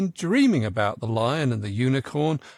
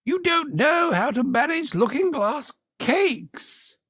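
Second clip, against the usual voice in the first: much higher, odd unicorn voice; lofty creature voice, pitched way up; the unicorn remarking; 13 semitones higher than usual. A narrator's reading. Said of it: "the unicorn remarked".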